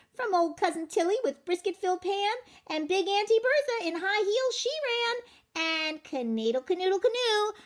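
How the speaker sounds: high, bouncy boastful chant; sing-song brag as the runaway cracker; cocky matzah man. Acted. Matzah man taunting the baker in a high boastful sing-song.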